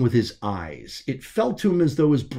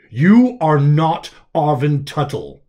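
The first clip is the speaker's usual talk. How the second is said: slow, louder declaration; weighty revelation; 3 semitones higher, 7 dB louder, slower than usual. Acted. Voice over the comms revealing his true identity, slow and weighty. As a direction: land the truth heavily.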